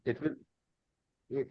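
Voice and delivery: normal talking voice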